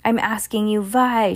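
a moderate mock scream; screamed question. A narrator's reading. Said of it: "she screamed".

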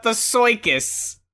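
"circus" said as "soy-kis"; mock the word "circus" with a cartoon oy vowel; cartoonish mispronunciation. Co-host echoing the singer's odd word, cartoonish "circus". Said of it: "'Cause we're fucking Bugs Bunny".

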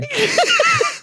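squealing high laugh. Real, not acted. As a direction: burst into laughter.

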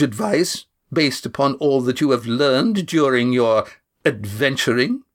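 mock her with cold sarcasm; sneering woman. Janice mocking Lindy.

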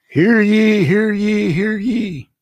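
high, loud herald's call; town crier. Pretend. Mocking royal proclamation announcement.

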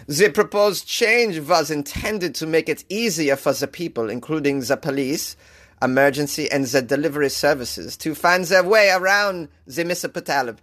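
put-on German-accented news read, stumbling on long words; clumsy fake German newsreader; bad German accent. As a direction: attempt a German accent on the report. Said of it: "say municipality with a German accent".

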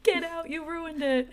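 high, loud outraged yell; angry shout. Retold or imitated. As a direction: scream at them for ruining the art. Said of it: "her friend, is like".

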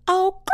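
high loud squeal of embarrassment; a sudden shriek at herself; embarrassed yelp. Real, not acted. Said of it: "I embarrassed myself".

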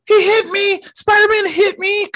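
shrill-high mock accusation; hammed-up Karen crying foul; very high imitation of an outraged Karen; full Karen. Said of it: "she went full Karen".

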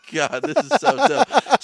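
high, slowed strained complaint; mock strain. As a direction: groan it out, struggling. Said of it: "I'm like".

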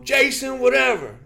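high, loud name call; projected gym-class roll call; coach's roll call. Retold or imitated. Coach calling tryout roll.

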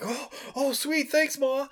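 bright put-on thanks; mock boy thanking his mother; excited boy.

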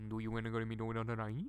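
fast garbled joke-language version; demonstrating the college secret language. Shown as a demonstration. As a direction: rattle it off in the friends' secret lingo. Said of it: "I'll say it how we would say it".

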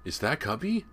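put-on young boy calling out excitedly; excited cry. A narrator's reading. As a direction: cry out a hopeful question, pitched well up. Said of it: "James cried".

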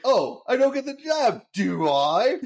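mocking the producer with a fake sob; mock sarcastic whining. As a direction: sneer it like a spoiled kid. Homer mocks producer's rejection.

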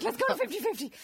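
high, bouncy burst of enthusiasm; eager suggestion. Retold or imitated. Retelling how she begs to go for cocktails, mock-eager. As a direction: pipe up excitedly, hamming up the eagerness.

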